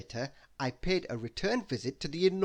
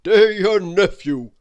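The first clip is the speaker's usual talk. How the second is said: put-on loud, hearty old uncle, pitched up; Father Christmas reading his letter; 10 dB louder than usual. Uncle writing to his nephew for Christmas help, hearty loud voice. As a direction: boom out a cheery letter from the North Pole.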